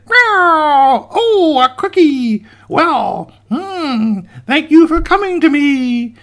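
loud, high yowling cat voice; gloating cat. Acted. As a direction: meow with drawn-out delight at the prey. Cat pounces on the cookie.

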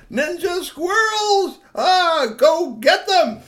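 very high, slow, loud shout; witch's alarm cry. A narrator's reading. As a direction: raise the alarm with long, high, shouted words.

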